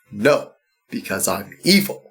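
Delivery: low, quiet evil villain; smug, low evil voice. Retold or imitated. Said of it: "playing a role as Lex Luthor".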